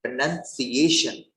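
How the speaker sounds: प्रोनाउंसिएशन slow, with an s sound before the ending; pronunciation with "sa". Shown as a demonstration. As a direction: sound out प्रोनाउंसिएशन slowly, stressing the s syllable. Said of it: "सा आएगा".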